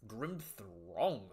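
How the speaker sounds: quiet, puzzled rising "Grim Throng?"; puzzled question. Shown as a demonstration. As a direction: ask "Grim Throng?" in disbelief, softly.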